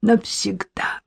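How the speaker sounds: strained, breaking whisper; tearful drunken lament; anguished. A narrator's reading. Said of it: "с надрывом завершил тираду".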